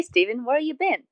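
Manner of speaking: ordinary talk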